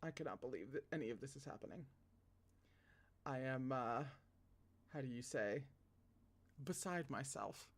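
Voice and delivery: soft, fast murmur; hushed aside. Real, not acted. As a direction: mutter it under your breath. Aside, mock composure.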